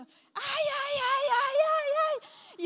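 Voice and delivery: loud, high wailing ay ay; mock crying. Hammed-up wailing ay ay, sore on the stairs. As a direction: wail like every step hurts.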